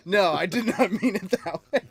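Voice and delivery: loud, high protest through laughter; real laughter breaking into the words; laughing protest. Real, not acted. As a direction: protest while cracking up. Laughing protest at teasing comment.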